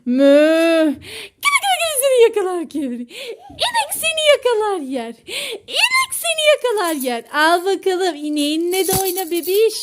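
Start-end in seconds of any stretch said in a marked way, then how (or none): 0.1-0.8 loud, low drawn-out moo; mooing cow. Pretend. Showing baby the toy cow, playful loud mooing. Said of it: "Bu inek olduğu için bu mölüyormuş". 0.9-7.2 high-pitched tickle-game voice; playful teasing. Pretend. Playing catch-you with baby and toy cow, playful teasing.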